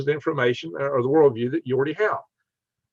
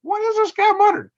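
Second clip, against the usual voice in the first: very high, loud puzzled question; retold bafflement; 5 dB louder than usual. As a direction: wonder aloud in a high, incredulous voice. Retells wondering about a muttering classmate.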